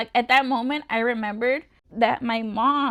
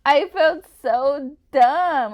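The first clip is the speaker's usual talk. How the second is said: slow and much louder, drawn out; loud, slow self-reproach; exasperated at herself; 3 semitones higher, 10 dB louder, much slower than usual. Real, not acted. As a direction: groan it at your own mistake. Admitting her diet mistake.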